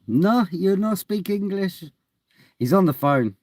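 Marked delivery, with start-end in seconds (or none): 0.1-1.8 put-on slow, high broken English in a light foreign accent; slow comic foreign-accent verdict; mock foreign accent.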